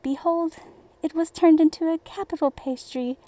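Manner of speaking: usual speaking voice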